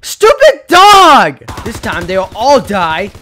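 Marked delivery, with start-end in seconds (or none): 0.1-1.4 slow, loud, high crying yell; anguished shout at the dog; furious wail. Real, not acted. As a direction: howl at the dog in despair. Dog kills him again.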